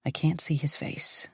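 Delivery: ordinary delivery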